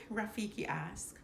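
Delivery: ordinary delivery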